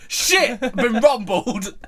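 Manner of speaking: loud, high panicked villain cry, slowing on rumbled; caught-out Dracula. Pretend. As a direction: yelp like a villain found out, then drag it.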